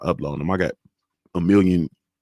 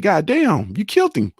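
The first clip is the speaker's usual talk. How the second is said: much higher, amazed exclamation; high pitched shocked trainer voice; mock trainer; 9 semitones higher than usual. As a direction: impersonate the stunned coach. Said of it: "Derrick James came in and said".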